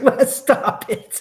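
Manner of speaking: laughing through it, slow and high. Real, not acted. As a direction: giggle it out in real amusement. Laughing at a chat comment.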